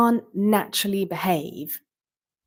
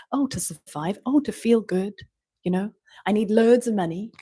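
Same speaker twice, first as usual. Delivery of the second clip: ordinary talk, nothing marked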